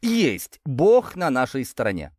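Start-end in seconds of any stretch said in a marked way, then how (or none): 0.0-0.6 higher triumphant yes. A narrator's reading. Hero hitting the fleeing man, triumphant exclaim. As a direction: celebrate the hit. Hit with the brick.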